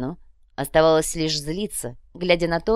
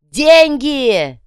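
high, full-force bellow; loud yell; 8 semitones higher, 10 dB louder than usual. A narrator's reading. Ваня yells to grab the brawlers' attention, bellowing. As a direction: yell it over the whole brawl. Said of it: "громко заорал".

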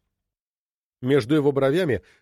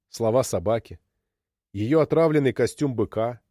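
usual speaking voice

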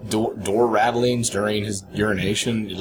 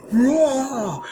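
mock monster roar; 13 semitones higher than usual. Imagining the huge guy bursting out of the bathroom, mock roar. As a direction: roar like a raging giant bursting through a door.

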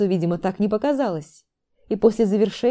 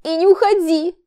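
high, loud pleading wail; plaintive sob; 10 semitones higher, 6 dB louder than usual. A narrator's reading. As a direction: beg him to stay, tearful. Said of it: "жалобно всхлипнула Виктория".

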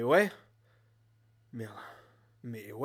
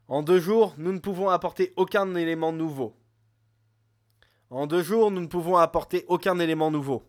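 formal announcement; 4 semitones higher, 7 dB louder than usual. Acted. Reads official announcement lines.

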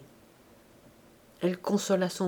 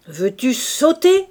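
loud, raised-pitch threatening yell; angry bellow; 5 semitones higher, 8 dB louder, slower than usual. A narrator's reading. Drunk father over the bed with a whip, furious bellow. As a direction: bark the order slowly and hard. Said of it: "gueula-t-il plus fort".

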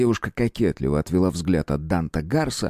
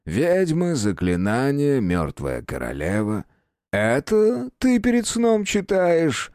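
hunter voice; 6 semitones higher, slower than usual. A narrator's reading. Hunter mocks princess.

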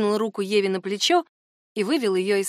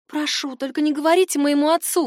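put-on higher-pitched girl's plea; pleading; 6 semitones higher than usual. A narrator's reading. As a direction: beg anxiously, voice lifted. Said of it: "взмолилась девушка".

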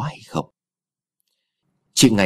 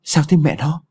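higher, anxious question; worried; 6 semitones higher than usual. Acted. Husband running in to his fallen wife, worried. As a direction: rush in asking with concern. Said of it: "giọng lo lắng".

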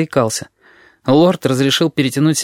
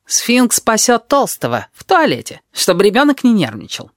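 high-pitched, slowish character voice; chattering Tabaki; 9 semitones higher than usual. A narrator's reading. Tabaki accounts for Sphinx's whereabouts, high chatter. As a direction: explain it in a piping put-on pitch.

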